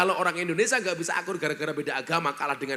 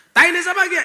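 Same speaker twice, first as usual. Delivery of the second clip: much higher, louder startled question; ngapak villager asking; 12 semitones higher, 10 dB louder, much faster than usual. Retold or imitated. Ngapak man who stepped in dung asks a Sundanese man, loud and startled. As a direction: blurt it out in shock. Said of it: "menurut orang ngapak udang".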